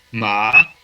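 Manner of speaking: ma rising like a question; second tone. Shown as a demonstration. Teacher showing the second tone of Chinese, ascending ma. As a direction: glide MA upward as if asking. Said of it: "el segundo tono es ascendente".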